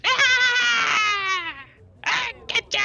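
very high, loud attack screech; shrieking little critter. Acted. Critter leaping onto Rain's back, shrieking attack. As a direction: squeal it out in a tiny critter's frenzy. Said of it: "she streaks towards you, and she goes".

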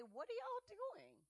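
high incredulous what are y'all doing; mock exasperated question. Preacher mocking what Jesus did not say, incredulous. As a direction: toss it up in disbelief. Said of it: "He didn't say".

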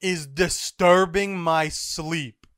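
loud, slow, booming demand; angrily woken swordsman. Acted. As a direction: boom it out slowly, outraged at being woken. Zoro woken from his nap.

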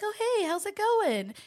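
high, bubbly customer greeting; put-on bubbly friendliness; cartoon character. Shown as a demonstration. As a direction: chirp hello in a high cartoon-like lilt. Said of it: "I'm a cartoon character".

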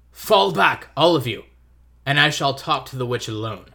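loud regal command; commanding lion shout. Acted. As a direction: boom it out like a king. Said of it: "said Aslan".

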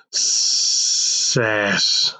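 stretched snake hiss on sass; drawn-out s like a snake. Pretend. Hissed word like a snake.

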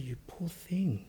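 soft, slow mock sympathy; pitying. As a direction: murmur it slowly with pity. Pitying reply to deluded believer.

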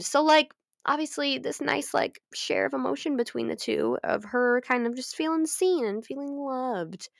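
ordinary talk

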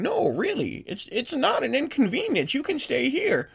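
put-on high-pitched pleading old lady; sweet but pushy high hostess voice; insistent old host. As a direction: pitch it up and keep pressing. Host voice keeps pressing guests.

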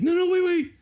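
very high, loud mock squeal; hammed-up last-second begging; imitated panicked pleading. Kid interrupting dad's countdown, mock panicked squeal.

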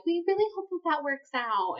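high, sugary fake well-wishing; mock polite parents. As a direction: imitate parents being insincerely nice. Voices parents' insincere well-wishing.

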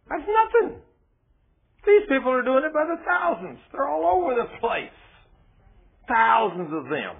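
high, loud, crying-out preacher; fiery preaching. Acted. Preacher crying out to his flock about the crowds, high and loud. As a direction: cry it out to the congregation.

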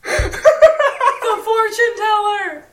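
high, slow laughing words. Real, not acted. Laughing at surname.

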